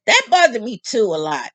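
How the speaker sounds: ordinary delivery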